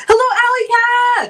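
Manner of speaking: high sing-out hello; called-out greeting. Real, not acted. Streamer welcomes a viewer to the chat, calling out high. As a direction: hail them in a high, held voice.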